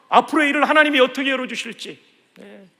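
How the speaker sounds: ordinary talk, nothing marked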